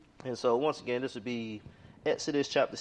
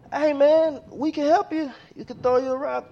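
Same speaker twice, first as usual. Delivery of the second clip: much higher, louder shout down from a ship; shouted call; 12 semitones higher, 8 dB louder than usual. Acted. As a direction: yell over the side to a man in the sea. Cruise ship crew shouting down.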